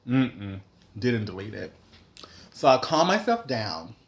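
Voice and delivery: usual speaking voice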